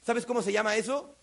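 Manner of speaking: usual speaking voice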